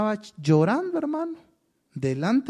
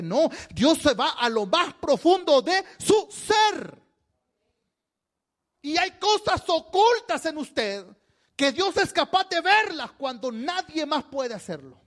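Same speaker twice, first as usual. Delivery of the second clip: high, slow, forceful sermon delivery; fervent preaching; 9 semitones higher, slower than usual. Real, not acted. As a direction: hammer out the truth phrase by phrase.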